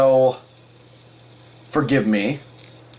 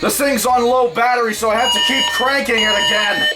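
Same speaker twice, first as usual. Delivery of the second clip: raised voice over the siren; shouting over fire alarm; 13 semitones higher than usual. Real, not acted. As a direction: shout above the alarm while cranking. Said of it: "My arm is starting to hurt".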